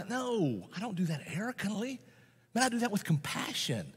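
ordinary delivery

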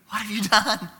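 mock-horrified questioning of a toddler; grandparent's startled question. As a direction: ask it in a high, aghast voice. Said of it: "What do you think I said to him?".

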